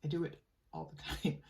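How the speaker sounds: ordinary delivery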